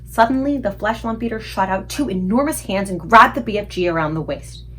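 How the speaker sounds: ordinary delivery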